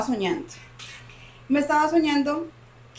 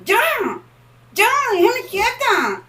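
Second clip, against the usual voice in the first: much higher, louder and slow defiant shout; telling it off; 12 semitones higher, 7 dB louder than usual. Retold or imitated. A woman in sleep paralysis yelling at the presence to leave her be, defiant and loud. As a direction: snap back at the presence to leave her alone. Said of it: "yo le alego".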